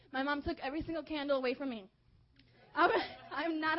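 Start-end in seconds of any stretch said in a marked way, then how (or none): none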